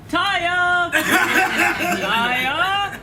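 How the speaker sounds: high calling father, laughing; worried father calling, giggling between calls. Acted. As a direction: call the name across the store. Father calling for lost daughter.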